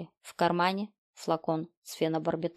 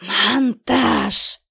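loud, raised, hissing villain voice; hissed exclamation; 5 semitones higher, 8 dB louder than usual. A narrator's reading. Killer springs his trick on the girl, loud hiss. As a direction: hiss МОНТАЖ out at high force. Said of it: "Голос зашипел".

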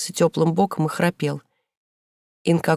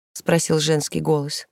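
plain reading voice

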